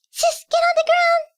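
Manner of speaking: high, loud warning yell; urgent shout. Acted. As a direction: yell it to save her sister. Warning sister of danger.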